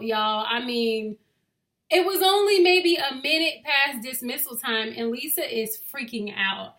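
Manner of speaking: ordinary delivery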